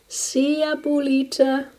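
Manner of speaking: high, airy, reluctant assent; resigned sigh. A narrator's reading. Martina yields to her grandmother, sighing. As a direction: give in on a lifted sigh. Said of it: "sighed Martina".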